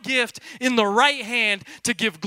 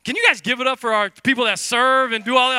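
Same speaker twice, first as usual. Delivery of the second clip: ordinary delivery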